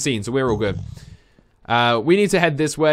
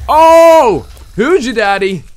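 loud, high gloating whoop; real victory shout; triumphant yell; 10 semitones higher, 11 dB louder, slower than usual. Real, not acted. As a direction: crow over the kill. Triumph after killing ghast.

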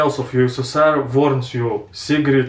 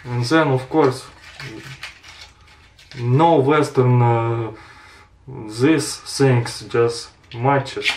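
talking around cigarette; cigarette in mouth; slower than usual. Real, not acted. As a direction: lips clamped on cigarette. Seen 0: Unlit cigarette held between lips throughout while speaking.

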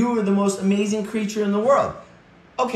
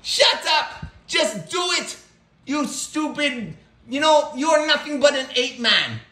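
cocky pet voice, higher, with mock shouts; voiced pet ranting; 9 semitones higher than usual. Pet Snarfles insulting his owner, bratty ranting creature voice. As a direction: throw a bratty pet tantrum.

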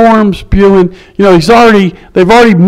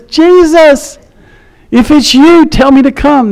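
high-pitched call, only mildly raised in volume; retold calling out; 8 semitones higher than usual. Peter calling to Jesus from the boat, imitated yelling out. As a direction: call out as if across water. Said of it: "he yells out and says".

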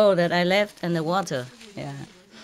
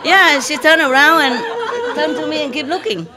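laughing; 7 semitones higher, 6 dB louder than usual. Real, not acted. Proud of cat trick.

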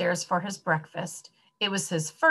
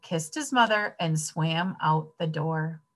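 usual speaking voice

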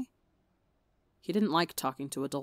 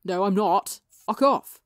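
embarrassed yell; 2 semitones higher, 9 dB louder, slower than usual. Acted. Tommy denying his nerves to teasing Wilbur, loud flustered shout.

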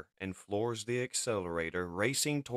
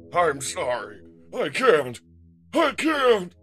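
breaking down, rising to a scream; panicked scream; 11 semitones higher, 13 dB louder, slower than usual. A narrator's reading. Ultra Magnus flees the battle, screaming. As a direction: build from loud apology to high scream. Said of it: "He screams as he flees".

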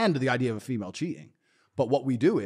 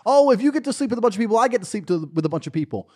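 high, louder, rushed mimicry; mock complaint; 8 semitones higher, 4 dB louder than usual. Mimicking females who demand the same, mock complaint. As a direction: imitate an indignant protest, pitched up.

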